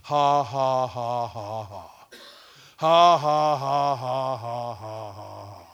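loud, slow ha-ha-ha fading down; measured laugh starting big and sinking in pitch; deliberate fake laugh. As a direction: laugh on purpose, syllable by syllable. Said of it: "He just laughed like this".

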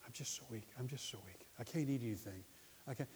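very soft, feeble near-whisper; mock frail murmur, fading out; weak patient. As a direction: imitate a sick woman with no strength. Imitates the weak cancer patient.

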